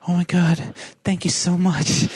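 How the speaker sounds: put-on a touch of a relieved, teary girl; tearful thanks. Crying girl thanks her rescuer.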